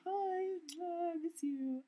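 high, drawn-out hello to friends; affectionate greeting. Real, not acted. To castmates who might be watching, high affectionate greeting. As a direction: sing out a sweet, high-pitched greeting.

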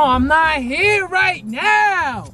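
very slow, stretched-out words; playful disclaimer. Pretend. Friend staying out of the quarrel, drawn-out disclaimer. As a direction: draw every word out long. Pretends to be absent, stepping aside.

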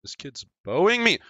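high, loud complaint; alarmed yell. Real, not acted. Gamer under bow fire, yelling high in complaint. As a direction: shout it out under attack. Being shot by an opponent.

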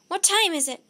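loud, high panicked question; urgent cry. A narrator's reading. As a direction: cry it out as if time is running out. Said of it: "cried Jack".

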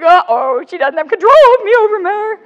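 high, loud retold panic; alarmed uh-oh. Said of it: "I'm like".

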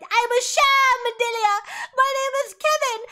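high, loud hammed-up outburst; mock confession wail. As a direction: cry it out in mock despair. Acting out Otho's panicked confession.

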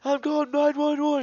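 put-on very high, loud squealing kid; mock hysterical threat. Stuck kid threatening his friends, mock hysterical squeal. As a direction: shriek it like a kid in a tantrum.

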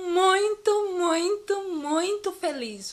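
playful, gushing delight; excited, very happy. Shown as a demonstration. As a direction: act overjoyed, stretching the words. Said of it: "eu estou empolgada com algo".

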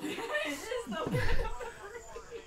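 high squeaky mock, slow. Mocking girlfriend's dream of America, squeaky voice. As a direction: parody her dreamy voice.

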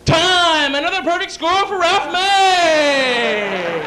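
loud, long-drawn arena call; triumphant announcer shout, last word held; rodeo announcer. Acted. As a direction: call the score, stretching out the name. Acted rodeo announcer calling score.

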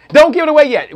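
loud, high interruption; playful protest. Real, not acted. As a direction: jump in loudly to hold them back. Host playfully stops guest giving gift.

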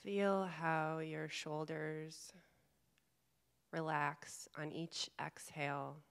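calm meditation guide, long pauses between phrases; guided meditation. Real, not acted. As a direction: gently guide them inward, leaving space to breathe. Leads a guided chakra meditation.

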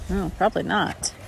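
ordinary delivery

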